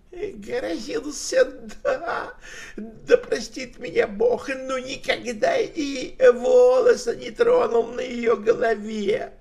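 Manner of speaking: put-on high, pleading old tramp with a laugh; whining old man. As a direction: whine and protest like a pitiful drunk. Old tramp pleads his innocence.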